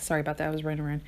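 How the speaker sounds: normal talking voice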